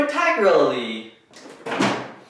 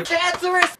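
squeaky high cancerous. Pretend. Playing with the word cancer.

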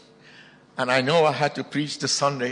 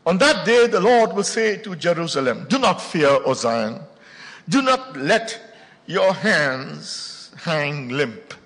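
preaching at full force; 5 dB louder, slower than usual. Acted. Preacher proclaims Zephaniah to the church at full force. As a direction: thunder the verses to the congregation.

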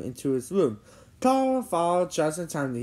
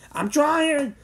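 high, loud retort; exasperated cry; 12 semitones higher, 5 dB louder than usual. A narrator's reading. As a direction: fire back under pressure. Jimmy answering frantic demand.

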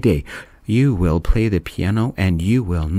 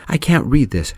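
mock scream, higher; 7 semitones higher than usual. A narrator's reading. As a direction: shriek it in frustration, lightly done. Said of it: "she screamed".

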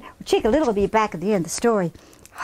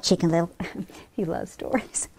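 plain reading voice

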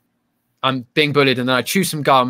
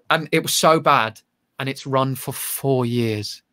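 ordinary talk, nothing marked

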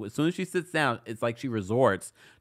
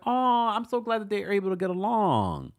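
mock gushing; 10 semitones higher than usual. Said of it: "I was like".

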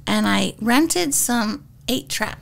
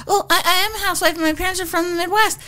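higher, rushed, over-eager answer; eager retort; 6 semitones higher, much faster than usual. Retold or imitated. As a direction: sell yourself quickly to the producer. Retelling her eager audition answer.

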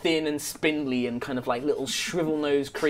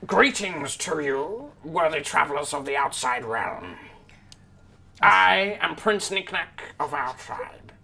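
slow, stately prince's greeting; princely kobold; 3 dB quieter, slower than usual. Acted. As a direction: introduce yourself with regal pomp, drawn out. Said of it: "looks quite princely and regal".

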